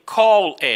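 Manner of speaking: called as two loud beats, call-ed; wrongly voiced E. Shown as a demonstration. Teacher showing what never to say, called with an extra syllable. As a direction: sound the E in called as its own syllable. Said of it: "You never say".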